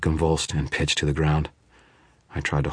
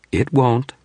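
assured; 9 semitones higher, 4 dB louder than usual. Acted. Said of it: "the shape assured me".

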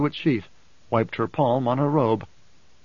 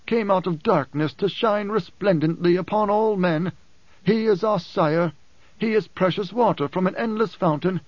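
higher, declaimed words of praise; mock panegyric; 6 semitones higher than usual. Quoting the emperor's panegyrist, grand declaimed praise. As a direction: recite it like a court flatterer.

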